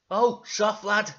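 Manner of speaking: scolding character. Acted. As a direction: threaten a naughty lad loudly. Zebedee scolds Dougal.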